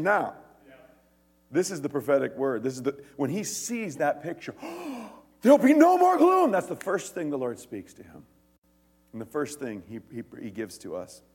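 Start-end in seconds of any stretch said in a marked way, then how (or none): 4.6-5.1 sudden acted gasp of awe; amazed gasp. Preacher acting the prophet seeing the picture, gasping. 5.4-6.5 high, loud burst of amazement; awed exclamation. Acted. Preacher acting the prophet seeing the picture, exclaiming. Acts prophet's reaction to the vision.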